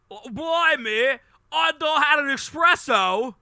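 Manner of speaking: high, loud, drawn-out British put-on; hammed-up British yell; mock British accent. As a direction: squawk it in an over-the-top British accent. Mocking British people's accent.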